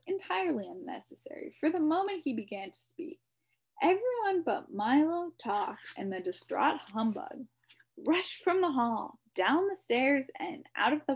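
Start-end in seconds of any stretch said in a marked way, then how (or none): none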